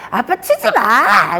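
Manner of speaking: put-on high, drawn-out child's objection; 아빠를 말리는 아이. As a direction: whine loudly like a kid stopping dad. 춤추는 아빠를 막는 아이. (아이처럼 높고 길게 떼쓰며)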